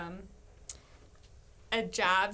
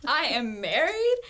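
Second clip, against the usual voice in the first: mock haughty; 4 semitones higher, 6 dB louder than usual. Woman recalls acting engaged while single, mock haughty. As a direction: wave off suitors with hammed-up self-importance. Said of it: "I started acting engaged".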